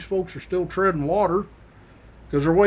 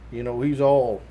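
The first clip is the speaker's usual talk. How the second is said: ordinary delivery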